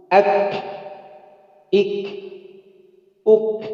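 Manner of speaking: Ak, ik, uk with the K pressed and held, then a catch of hamzah; held kaf before hamzah. Shown as a demonstration. Teacher demonstrating held kaf with hamzah release, deliberate. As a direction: stop hard on the K, then release. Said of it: "itu baru keluar hamzah".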